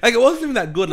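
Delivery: laughing through the words; high and loud, laughing it off. Real, not acted. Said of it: "You're just choking on how good".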